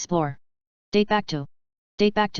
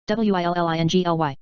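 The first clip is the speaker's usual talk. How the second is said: letters rattled off fast; quick spelling; 3 dB louder, faster than usual. Shown as a demonstration. Teacher spelling the word fast for the class. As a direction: run through the letters briskly. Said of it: "spelling drill, slow then fast".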